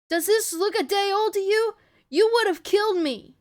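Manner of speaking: high, loud challenge; heated protest. A narrator's reading. Ellie confronting Tess about her bite, heated protest.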